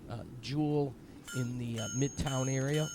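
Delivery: ordinary talk, nothing marked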